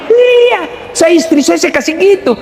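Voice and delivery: high, squealy pleased wife; mock delighted wife. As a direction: gush like a flattered wife. (istri girang, suara tinggi melengking)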